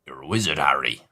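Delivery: put-on deep low West Country Hagrid accent, retried; low rumbling Hagrid accent. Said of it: "that's it again".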